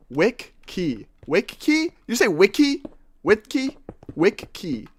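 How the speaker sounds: "Wiki" split as WICK-KEY; two separate Ks in "Wiki", puzzled; spelt-out wiki. Shown as a demonstration. Streamer testing a chatter's spelling, questioning WICK-KEY. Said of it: "like how you just spelt it".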